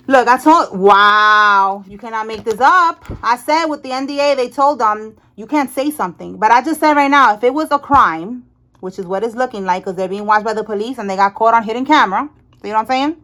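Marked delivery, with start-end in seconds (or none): none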